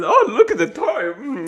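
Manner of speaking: high, drawn-out imitation of nonchalance; mock-casual. Streamer mocking the anchor acting cool, mock-casual. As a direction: draw it out as if pretending to be cool. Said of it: "He's acting, like, cool about it".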